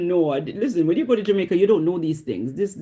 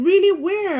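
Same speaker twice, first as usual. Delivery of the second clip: high, loud acted interest; eager surprise; 13 semitones higher, 5 dB louder than usual. Driver hearing officer has been to Canada, high acted surprise. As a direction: pitch it right up, keenly curious.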